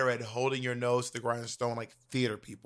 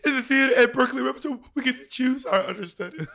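laughing; 11 semitones higher, 7 dB louder than usual. Real, not acted. Still laughing after the punchline.

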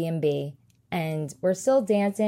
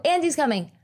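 retold mock scream; 7 semitones higher, faster than usual. Plopped on the floor as Jessie warning the toys, mild mock scream. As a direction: call it out as a mild mock yell. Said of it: "I scream".

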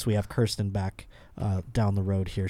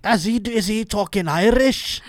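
high put-on Scottish accent; a quick stage Scottish voice; Scottish accent, pitched up; 10 semitones higher, 4 dB louder than usual. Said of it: "I did Scottish there".

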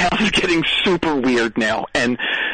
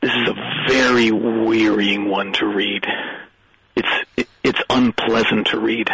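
a hint of a weary old wine-ad narrator; Orson Welles impression; 7 semitones lower than usual. Kid in a cape grumbles to the comedian in a lightly voiced Orson Welles. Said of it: "trick-or-treating as Orson Welles".

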